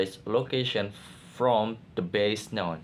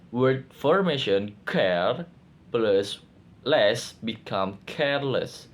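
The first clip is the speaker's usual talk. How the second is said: ordinary talk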